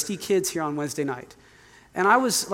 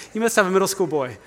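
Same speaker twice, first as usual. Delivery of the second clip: quick words through a laugh; fast laughing joke; laughing tease; 4 dB louder, faster than usual. Real, not acted. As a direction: tease them, chuckling. Joking with the audience while laughing.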